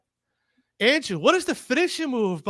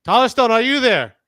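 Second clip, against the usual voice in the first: loud, higher call to a caller; shouting into a phone; 4 semitones higher, 8 dB louder than usual. Real, not acted. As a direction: call out down the line. Calling a guest on call.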